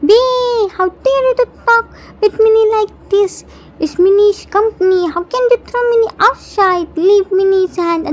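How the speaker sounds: put-on high, fast indignant little-boy shout; a small boy yelling back in outrage; child shouting. A narrator's reading. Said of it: "Jimin shouts".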